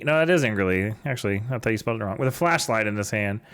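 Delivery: usual speaking voice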